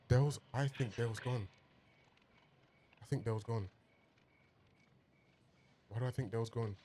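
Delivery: half to herself, dreading it; worried murmur. Real, not acted. Fearing Dale is dead, soft and worried. Worried viewer fears character died.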